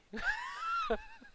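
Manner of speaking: a high, loud real laugh; loud burst of laughter. Real, not acted. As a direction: let out a big shrieking laugh. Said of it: "laugh shout".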